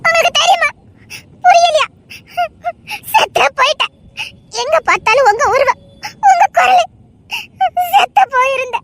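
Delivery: high, tearful pleading that cracks; crying, voice breaking. Acted. Daughter to her father, crying with a breaking voice. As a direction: sob out how much you missed him.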